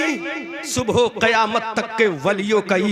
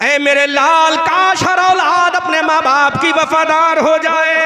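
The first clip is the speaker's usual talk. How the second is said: loud sermon refrain; preached refrain; 5 semitones higher, 10 dB louder than usual. Acted. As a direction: hammer the wish home. Repeats wish loudly. (ज़ोरदार दोहराव)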